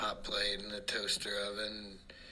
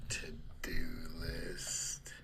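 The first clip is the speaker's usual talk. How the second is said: whispered aside; 4 semitones lower, much slower than usual. Acted. Deadpan repeat of punchline.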